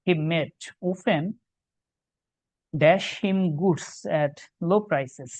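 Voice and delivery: reading the sentence very slowly to the class; every word spaced for dictation; slow exam-question read. Shown as a demonstration. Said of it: "দেখো". (প্রশ্নটি খুব ধীরে পড়া)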